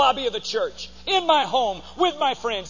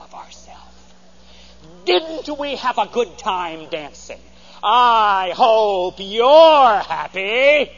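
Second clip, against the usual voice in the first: lightly voiced cold, sarcastic wife, last line slow and louder; mock icy scolding, dragging out the end; 4 dB louder, slower than usual. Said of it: "Michal scolds David at door".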